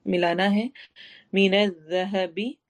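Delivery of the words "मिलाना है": ordinary talk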